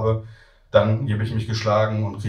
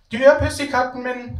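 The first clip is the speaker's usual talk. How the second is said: put-on high, slow child's cry; a little kid claiming his cat; shouting small child; 3 dB louder, slower than usual. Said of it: "schreit das erste Kind".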